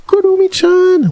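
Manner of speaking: gasp, then very high delighted squeal; high-pitched, thrilled exclamation; excited squeal. Real, not acted. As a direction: squeal the name like a fan. Recognizes the name, gasps, exclaims.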